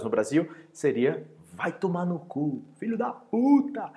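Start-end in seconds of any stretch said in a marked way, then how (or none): 1.5-3.9 mock furious swearing; angry insult. Shown as a demonstration. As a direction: spit the insult out slowly.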